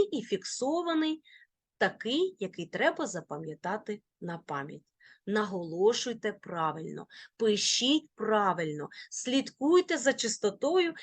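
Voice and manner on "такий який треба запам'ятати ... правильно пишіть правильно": ordinary talk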